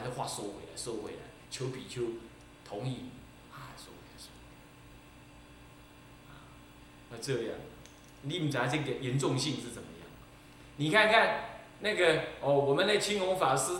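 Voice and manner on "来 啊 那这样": normal talking voice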